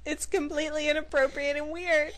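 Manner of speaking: frantic pleading. Retold or imitated. Pleading with her friend not to ask the waiter, rising panic. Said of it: "I'm like".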